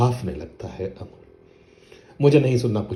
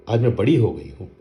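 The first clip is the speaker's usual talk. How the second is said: proud insistence; 3 semitones higher, 7 dB louder than usual. Acted. Daughter insists to her father she is grown up, proudly. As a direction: stress on बड़ी, said proudly and a bit louder.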